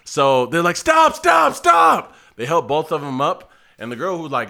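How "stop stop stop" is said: loud, high repeated command; urgent shouting. Retold or imitated. Onlookers step in between fighters, urgently shouting. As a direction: imitate people breaking up a fight.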